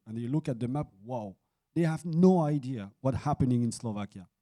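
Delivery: ordinary talk, nothing marked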